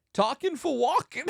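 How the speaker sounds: high, loud celebrity impression; hammed-up punchline, breaking into laughter; Christopher Walken. Comic alone on podcast slipping into star impression.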